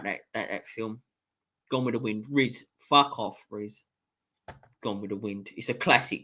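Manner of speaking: ordinary talk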